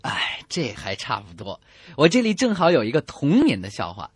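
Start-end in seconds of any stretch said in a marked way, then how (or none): none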